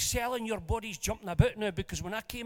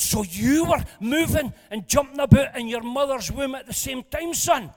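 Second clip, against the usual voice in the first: raised, higher excited question; excited call out; 5 semitones higher, 9 dB louder than usual. Retold or imitated. Preacher delightedly asks the young waiter, loud and high.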